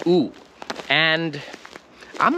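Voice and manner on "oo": oo as in "food"; a pure long oo; foreign U sound. Shown as a demonstration. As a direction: say oo with rounded lips. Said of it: "the letter U is pronounced oo".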